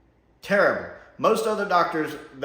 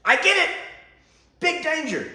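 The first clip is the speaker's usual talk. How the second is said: much higher and louder, fed up with the speech; exasperated interruption; 13 semitones higher, 4 dB louder than usual. Retold or imitated. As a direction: cut the lecture short. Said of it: "watching the screen going".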